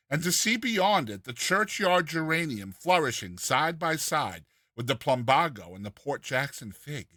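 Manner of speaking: ordinary delivery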